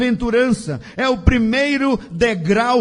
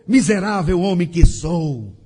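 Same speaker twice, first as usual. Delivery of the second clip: lower, subdued acted lament; crying out in lament; 6 semitones lower than usual. Preacher quotes Paul on his wretchedness, low lamenting cry. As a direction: let the pitch sink in self-reproach. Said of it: "Paulo clamou".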